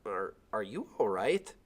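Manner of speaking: slow, hesitant, odd-sounding question; funny voice. Acted. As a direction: stumble into it, unsettled. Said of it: "In a funny voice".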